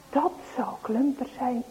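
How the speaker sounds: unvoiced whisper; whispered fear. Boys whispering as they hear the farmer. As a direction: breathe it so nobody hears.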